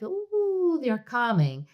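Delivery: high, drawn-out cooing "ooh"; delighted synapses. Acted. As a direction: coo it high, as if pleasantly soothed. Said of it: "all those neuronal synapses, like, like".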